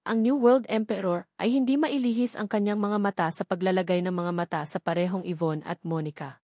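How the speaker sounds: usual speaking voice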